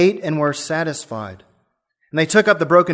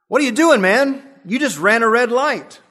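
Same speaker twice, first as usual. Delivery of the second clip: high, loud alarmed shout; retold yell; 8 semitones higher, 3 dB louder than usual. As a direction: yell it at the reckless driver. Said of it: "yelled to the driver".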